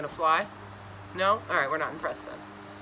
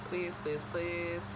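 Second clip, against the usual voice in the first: soft pleading; 8 dB quieter, slower than usual. Real, not acted. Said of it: "begging the viewer".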